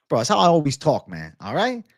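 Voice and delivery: ordinary talk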